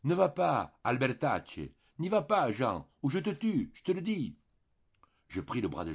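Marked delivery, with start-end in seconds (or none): 0.0-4.4 loud, much higher threat; threatening shout. A narrator's reading. Mathieu threatening Jean over a girl, shouting. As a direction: yell the warning with menace. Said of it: "cria-t-il".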